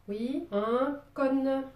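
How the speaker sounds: cône with a long open southern o, said slowly and drawn out; accent du sud. Shown as a demonstration. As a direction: say cône the Marseille way, slow and long. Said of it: "avec mon accent du sud". (accent marseillais, « cône » lent et ouvert)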